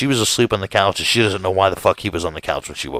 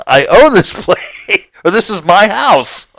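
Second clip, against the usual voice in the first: higher, slow, baffled disbelief; incredulous; 9 semitones higher, 5 dB louder, slower than usual. Retold or imitated. Homeowner finds a stranger in his house, slow disbelief. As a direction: spell it out in disbelief.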